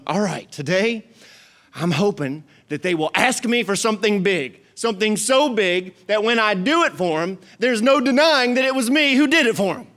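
God longing to be asked, building up; hopeful God. Retold or imitated. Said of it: "if he's going".

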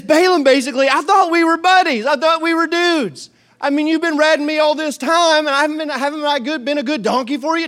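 high-pitched, hurt protest from a donkey; little talking donkey. Acted. Donkey pleads with Balaam who beat it, high and indignant. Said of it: "So the little donkey says".

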